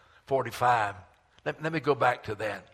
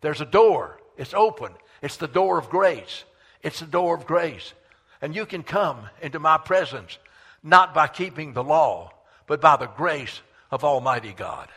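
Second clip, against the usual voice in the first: emphatic, repeated phrases building in force; preached proclamation; 3 semitones higher, 6 dB louder than usual. Acted. God inviting people through the door of grace, preached proclamation. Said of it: "he was saying".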